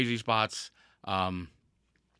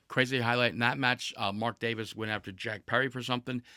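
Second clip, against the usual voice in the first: ordinary talk, nothing marked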